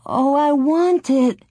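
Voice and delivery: put-on high, drawn-out childish begging. A narrator's reading. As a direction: wail it like a pleading little girl. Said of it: "Laura begged".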